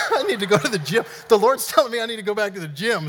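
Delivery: laughing, winded, high and loud; real breathless laughter in the voice; out of breath. Real, not acted. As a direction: puff it out after a sprint. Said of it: "I'm out of breath right now".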